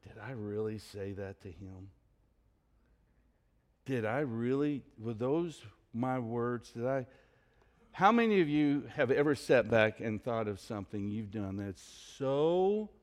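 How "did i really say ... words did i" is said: much softer, lower self-reproach; embarrassed muttering. Retold or imitated. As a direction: replay it quietly to yourself, cringing. Woman replaying her words alone.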